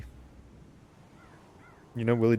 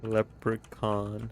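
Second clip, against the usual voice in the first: stretched slowly while typing; slow drawn out; 6 dB quieter, much slower than usual. Real, not acted. Streamer spells the new hero name, slowly drawn out. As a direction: drag each syllable out as you type.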